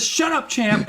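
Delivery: high, sharp mock shout; aggressive yell. Grumpy author silencing Champ, aggressive yell. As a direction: re-enact the angry outburst at high pitch. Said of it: "to say it less loud, and not as aggressively".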